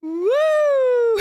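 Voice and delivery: a soaring party whoop; joyful high party cheer; high excited woo. Real, not acted. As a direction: whoop it out high, thrilled and celebrating. Party celebration with music.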